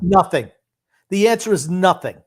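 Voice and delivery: emphatic. Real, not acted. Cutting off cohost's rambling, loud insistence. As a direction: slam the point home on "Nothing".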